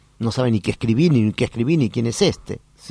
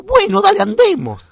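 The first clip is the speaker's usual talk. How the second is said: loud, much higher exclamation; excited agreement; 8 dB louder than usual. Retold or imitated. Therapist urging the father to start driving, loud high excitement.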